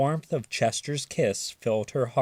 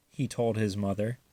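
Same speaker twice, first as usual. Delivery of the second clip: usual speaking voice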